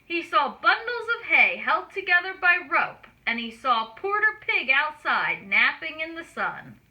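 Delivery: ordinary delivery, nothing marked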